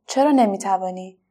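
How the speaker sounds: plain reading voice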